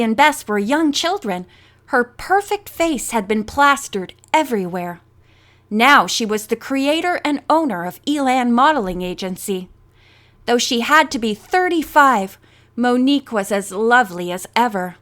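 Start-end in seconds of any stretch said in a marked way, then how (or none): none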